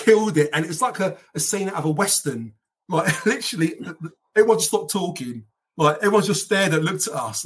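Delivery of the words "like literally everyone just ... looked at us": ordinary talk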